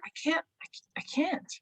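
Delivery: high, quiet protest, cutting off; overwhelmed reaction. Real, not acted. Owner facing longer new-patient appointments, overwhelmed. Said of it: "See, there's my, that, that reaction".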